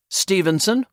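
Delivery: a high call across the room, rising at the end. A narrator's reading. Manager calling a new arrival over, called out high. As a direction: call his name to get his attention. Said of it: "Cooper called out".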